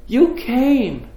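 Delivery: high, loud, overwhelmed cry; tearful amazement. Retold or imitated. Sister hugging the brother who came, tearful amazement. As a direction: imitate someone moved to tears by an arrival. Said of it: "and she's crying".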